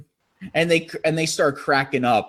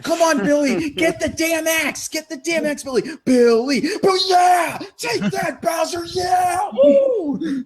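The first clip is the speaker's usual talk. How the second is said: mock hype. Imitating a youtuber cheering himself on in a boss fight. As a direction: shout him on like a crazed fan. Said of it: "He's like".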